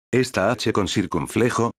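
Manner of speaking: normal talking voice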